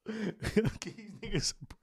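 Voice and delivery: drawn out slowly through laughter; laughing. Real, not acted. Host joking his hiatus lets rivals catch up, laughing. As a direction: crack yourself up on the line.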